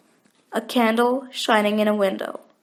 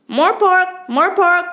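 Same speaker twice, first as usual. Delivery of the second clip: owl call; 4 semitones higher, slower than usual. A narrator's reading. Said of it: "the first owl from the hillside answered".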